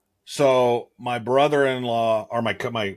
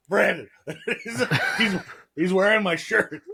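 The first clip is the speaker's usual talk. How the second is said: laughing retold call; 10 semitones higher than usual. Brother's retold shocked whisper-call.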